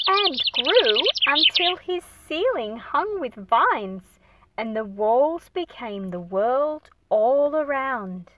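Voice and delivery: put-on expressive read-aloud for young listeners; storybook narration. A narrator's reading. Teacher reading the picture book aloud to her class, expressive narration. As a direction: paint the pages with a lively storytelling voice.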